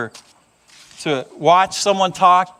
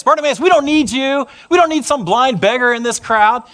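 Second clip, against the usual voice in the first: higher, dismissive crowd voice; scornful rebuke; 6 semitones higher, 4 dB louder than usual. Retold or imitated. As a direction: shoo the beggar away with contempt. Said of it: "Rebuking Bartimaeus".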